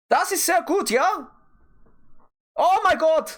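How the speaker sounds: very high, hammed-up German accent; comic falsetto German voice. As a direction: squeal it in mock German. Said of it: "do my German accent".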